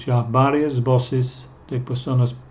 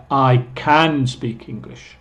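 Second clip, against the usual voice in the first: slow down and lean on "can"; "can" said full and stressed, not reduced; emphatic can; slower than usual. Shown as a demonstration. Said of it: "cuando queremos dar énfasis".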